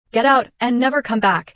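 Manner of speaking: plain reading voice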